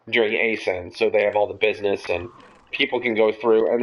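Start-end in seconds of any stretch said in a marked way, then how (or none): none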